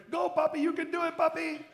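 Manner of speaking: high-pitched imitation of cheering kids; children cheering. Kids cheering their father on his long run, high mock-child voice. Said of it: "my son and my daughters were there".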